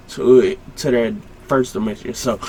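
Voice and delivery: usual speaking voice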